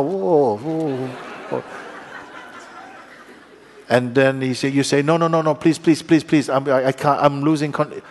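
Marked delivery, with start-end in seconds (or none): 0.0-1.6 mock alarm. Car skidding in rain. 5.0-8.0 higher, louder pleading in mock panic. Learner begging the instructor on the highway, mock panic. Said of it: "you panic".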